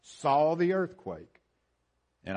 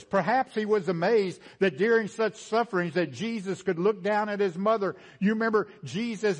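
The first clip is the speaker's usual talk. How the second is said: loud, higher preaching voice, slowing at the end; preaching at full force; 7 semitones higher, 5 dB louder than usual. Acted. Preacher to congregation, about the centurion: full-force preaching. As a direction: drive it home from the pulpit.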